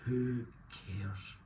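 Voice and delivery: normal talking voice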